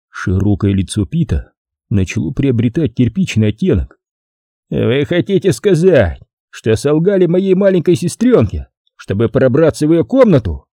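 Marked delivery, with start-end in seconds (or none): 4.7-10.7 growled. A narrator's reading. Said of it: "прорычал он".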